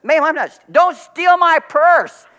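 high, loud, flustered mock cry; flustered protest. Pastor retells his flustered answer to the woman, high and loud. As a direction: blurt it out in a panic. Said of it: "I looked up and I said".

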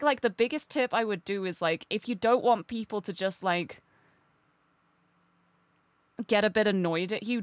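ordinary talk, nothing marked

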